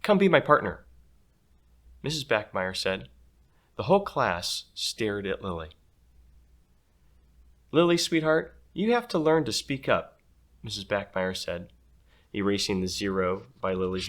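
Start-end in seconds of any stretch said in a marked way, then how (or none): 0.0-0.9 loud, high bossy teacher voice; the class teacher calling across the room, loud. Acted. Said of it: "Her voice was so loud". 7.7-10.2 high, loud sweet-but-bossy teacher; teacher urging a shy girl, loud and high. Acted. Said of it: "Her voice was so loud".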